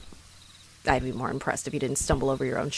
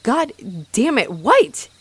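higher, louder flustered snap; embarrassed exclamation, higher and louder; flustered outburst; 6 semitones higher, 5 dB louder, slower than usual. Acted. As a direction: blurt it out, caught off guard. Flustered outburst when caught.

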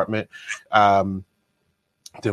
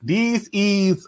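mock announcer; 10 semitones higher than usual. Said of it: "I'm just playing".

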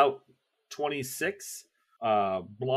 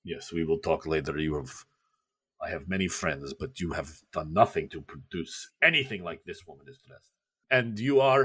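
Khrushchev voice; 6 semitones lower than usual. Acted. Khrushchev dismissing his date, low. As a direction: brush her off, low. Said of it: "he says".

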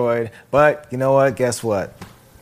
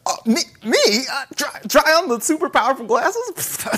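Peter's voice; 10 semitones higher than usual. Retold or imitated. Retelling a scene to friends, Peter as an eager higher kid. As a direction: play the wide-eyed kid asking back in disbelief. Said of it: "And he's like".

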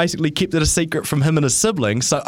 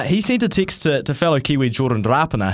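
normal talking voice

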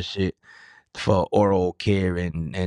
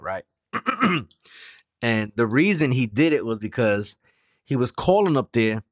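ordinary talk, nothing marked

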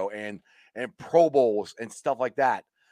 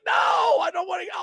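much higher shouted protest; panicked protest. Retold or imitated. Fan retelling his panic over the onside kick, high shout. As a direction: protest in mock horror, pitch way up. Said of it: "I'm like".

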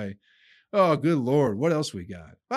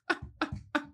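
a high, breathy burst of laughter; breathless laugh; 5 dB quieter than usual. Real, not acted. Laughing at his own dark joke.

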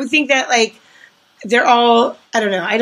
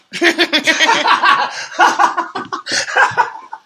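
long laugh; 8 semitones higher than usual. Real, not acted. Laughing at her own slogan.

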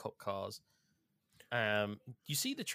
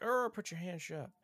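much higher, put-on cop voice; mock police order; 9 semitones higher than usual. Police getting out of their cars, mock order. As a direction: bark it like a cop out of a cartoon. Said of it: "they're like".

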